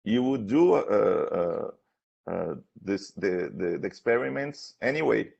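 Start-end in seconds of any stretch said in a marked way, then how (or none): none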